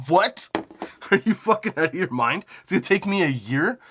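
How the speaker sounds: retelling his outrage at the wait; incredulous outrage. Retold or imitated. Retold outrage at year-long wait.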